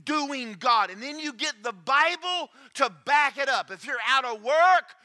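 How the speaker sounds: loud, high preaching cadence; preaching at full force. Acted. Preacher rallying the congregation, loud and high. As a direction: shout the promise to the congregation.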